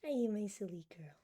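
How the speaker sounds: soft cooing whisper; whispered to cat. Real, not acted. Streamer cooing to her cat, whispered. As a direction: whisper it fondly to your cat.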